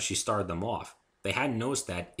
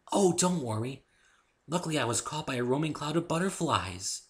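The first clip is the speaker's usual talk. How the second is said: gentle Fluttershy voice; 8 semitones higher, 3 dB louder than usual. Acted. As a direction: speak sweetly and timidly.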